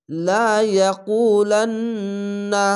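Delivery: slow, drawn-out chanted recitation with nasal hold; Quran recitation. Shown as a demonstration. Teacher reciting a verse to students, slow and drawn-out. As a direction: recite it slowly, stretching each sound. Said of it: "এখানে ওয়াজিব গুন্না".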